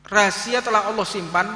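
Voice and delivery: loud, high, urgent sermon delivery; fiery preaching. Real, not acted. Preacher warning the congregation they may not meet again, urgent and loud. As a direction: warn them their time is short. Preaches on death and limited time.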